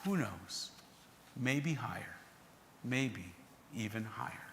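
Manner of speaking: soft, low, hushed musing; quiet voice. Acted. Former scoffer nodding, adding his thought quietly and low. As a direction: drop to almost nothing, wondering aloud. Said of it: "add in a quiet voice".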